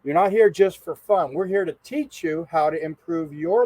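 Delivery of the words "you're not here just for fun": ordinary talk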